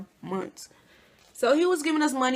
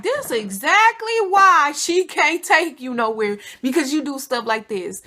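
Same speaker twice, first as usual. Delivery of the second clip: scolding loud; 5 semitones higher, 7 dB louder than usual. Retold or imitated. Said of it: "I'm like".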